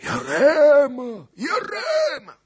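very high, long drawn-out yell; stretched, piercing battle call; war cry. A narrator's reading. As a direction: shout the name as a rallying cry.

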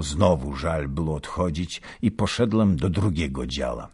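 ordinary delivery, nothing marked

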